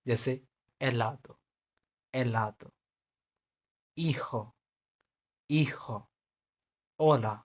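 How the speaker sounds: helado, hijo and hola with no h at all, starting straight on the vowel; silent h. Shown as a demonstration. Teacher showing learners the Spanish h is silent. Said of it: "उसे pronounce ही नहीं करना".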